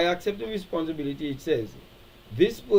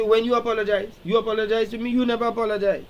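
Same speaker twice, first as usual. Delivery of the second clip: raised, accusing voice, pitched higher; aggrieved complaint; 6 semitones higher, 3 dB louder, faster than usual. Retold or imitated. Wronged partner accusing the other of never apologizing, raised and higher. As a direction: throw the hurt back at them, a touch louder. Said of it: "And the person say".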